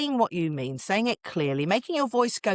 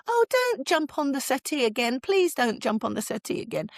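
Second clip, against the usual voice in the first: higher-pitched, wheedling parent's plea; pleading appeal; 7 semitones higher than usual. Shown as a demonstration. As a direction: appeal to the child's better nature. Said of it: "the kids can hear in your voice ... you're kind of appealing to their better nature".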